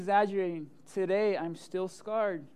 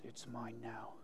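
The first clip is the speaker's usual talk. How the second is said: very low, hushed rooster voice; menacing rooster; 9 semitones lower, 18 dB quieter, slower than usual. Retold or imitated. Rooster claiming the backyard, low and menacing. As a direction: growl it as the villain of the yard. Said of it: "He goes".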